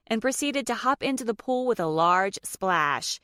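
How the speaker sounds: plain reading voice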